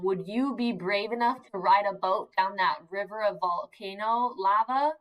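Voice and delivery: ordinary talk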